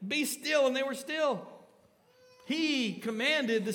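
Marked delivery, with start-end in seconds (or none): 0.1-0.7 higher and firm, command the waves. Acted. Jesus commanding wind and waves, firm and raised. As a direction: order the storm to stop.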